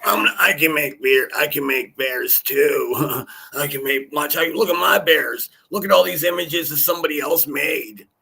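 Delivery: lampoon her bragging about bears; mocking a crackhead. Streamer mocking a rival bragging about bear images, mock shout. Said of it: "the crackhead over there is just like".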